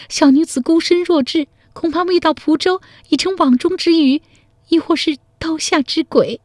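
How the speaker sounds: put-on a frightened girl explaining the dangers ahead; worried young woman. A girl fears the long road home alone, high and anxious. Narrator voices Zhao Jinniang.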